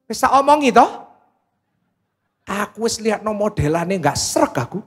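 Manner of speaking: jab it like a wife saying I told you so. Acted. Scolding wife in Javanese.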